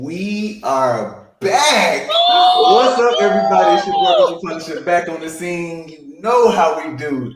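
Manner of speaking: loud, high shouted hype-man intro; big booming announcer entrance; hype host intro. Acted. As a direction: blast it like a stage MC. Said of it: "He does that all the time".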